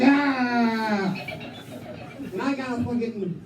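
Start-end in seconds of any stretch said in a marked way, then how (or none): 0.0-1.1 a short hammed-up cry of delight; mock yelp. Teen son in the shower all day, with a hammed-up yelp. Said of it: "Just all day long".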